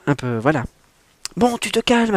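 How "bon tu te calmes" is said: loud, high bark; high, loud sharp command; barked order. Acted. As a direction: snap her out of panic. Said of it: "aboya-t-elle".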